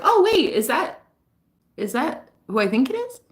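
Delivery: high, hesitant double-take; retold surprise. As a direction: act out a startled realization. Retold surprise at seeing him.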